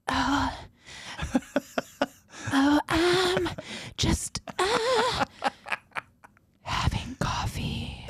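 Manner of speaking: high, breathy, trailing into a whisper. Retold or imitated. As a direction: play it coy and sexy, barely there.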